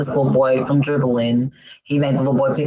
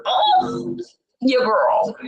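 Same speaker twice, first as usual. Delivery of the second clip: lost voice, raspy; hoarse voice; 6 semitones higher, much slower than usual. Real, not acted. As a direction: croak it out, voice gone. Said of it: "This ain't what I really sound like".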